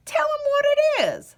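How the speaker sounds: high, fast crone voice; old woman prodding the old man; old woman urging. Acted. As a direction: hurry him along like a fussy old woman. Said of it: "suggested the old woman".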